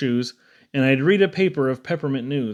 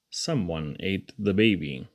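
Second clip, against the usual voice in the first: mock mournful; 8 semitones lower, 6 dB quieter than usual. A narrator's reading. Refrain said low and slow.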